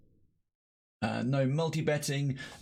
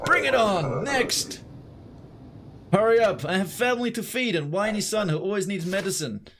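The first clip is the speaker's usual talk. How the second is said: put-on Russian accent; 7 semitones higher than usual. Entrant at the border booth demands to hurry in a Russian accent. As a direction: bark it like a pushy Russian traveller.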